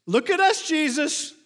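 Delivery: very high, loud, mock bragging; hammed-up proud call; showy boast. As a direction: demand attention like a show-off disciple. Acts Peter boasting to Jesus.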